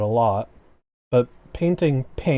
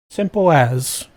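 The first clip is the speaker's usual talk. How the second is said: Simple as in a jokey British accent, louder; hammed-up British accent on the catchphrase; 3 semitones higher, 4 dB louder, slower than usual. Shown as a demonstration. Streamer repeats her catchphrase in a mock British accent. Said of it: "my offensive British accent".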